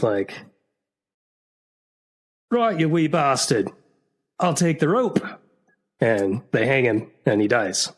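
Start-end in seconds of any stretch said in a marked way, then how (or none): 2.5-5.2 a higher, put-on English accent; a clumsy stab at an English accent, lifted high; amateur English accent in a joke; English accent attempt. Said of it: "attempt three different accents".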